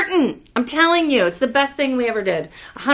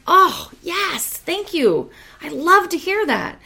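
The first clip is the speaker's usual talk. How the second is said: higher, gushing thanks; delighted relief; 7 semitones higher, 4 dB louder, slower than usual. Retold or imitated. Speaker thanking her friend for praising the book, delighted.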